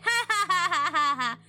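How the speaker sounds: high, gloating read-aloud laugh; mocking laugh. A narrator's reading. Lindy laughing at her sister, gloating cackle. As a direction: laugh at your twin's fear. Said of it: "She closed her eyes and laughed".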